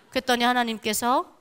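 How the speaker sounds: ordinary talk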